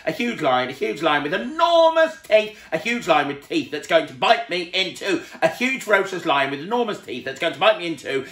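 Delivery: high, loud building panic; panicking. A narrator's reading. Mister Jelly hearing the tramp snore as a lion, loud building panic. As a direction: work yourself into a terrified frenzy.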